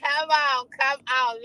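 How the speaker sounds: ordinary delivery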